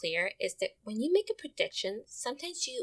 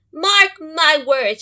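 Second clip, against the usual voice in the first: high, loud and slow, a fussy mother squirrel warning; a loud, slow mother's warning; stern Mama Squirrel; 8 semitones higher, 15 dB louder, much slower than usual. Acted. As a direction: lay down the law, drawing each word out.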